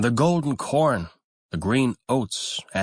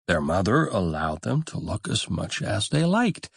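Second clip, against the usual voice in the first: usual speaking voice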